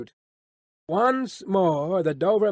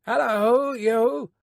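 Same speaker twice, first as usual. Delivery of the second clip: high calling hail; 7 semitones higher, 4 dB louder, much slower than usual. A narrator's reading. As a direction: hail the rider from the coach, pitched up. Guard hails the rider.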